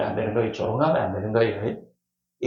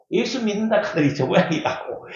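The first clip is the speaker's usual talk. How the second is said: raised-pitch jeer at believers; mocking; 6 semitones higher than usual. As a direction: act out a scoffer's taunt. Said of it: "그걸 비방한 거예요".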